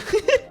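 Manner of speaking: high laugh; burst of laughter. Real, not acted. Laughs at own joke.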